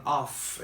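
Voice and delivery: "of" said as "off", ending in a long f; "off" with F sound. Shown as a demonstration. Teacher explains double F spelling, "of" with a voiceless f. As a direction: stretch the hissing f at the end of "of". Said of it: "es cuando tengo doble F".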